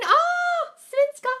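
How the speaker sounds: high excited exclamation; delighted squeal. Real, not acted. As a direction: squeal with joy at the country. Delighted at a viewer's country.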